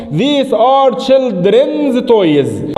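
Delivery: very slow teacher model, every word drawn out; slow model sentence. Shown as a demonstration. Teacher modelling the English sentence very slowly. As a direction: spell it out word by word. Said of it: "هذه ألعاب الأطفال".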